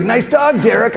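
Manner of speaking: high-pitched teasing jab; mocking buddy. Buddy teases him over the Chihuahua, high mocking tone.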